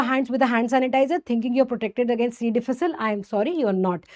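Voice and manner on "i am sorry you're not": usual speaking voice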